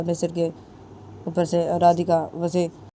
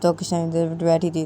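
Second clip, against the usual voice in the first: ordinary delivery, nothing marked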